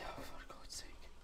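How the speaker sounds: breathy frightened whisper. Real, not acted. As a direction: whisper it, still shaken. Whispered after scare.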